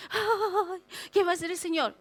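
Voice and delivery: hammed-up sigh into a worried question; desespero fingido. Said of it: "Me desespero". (lamento exagerado de desesperación)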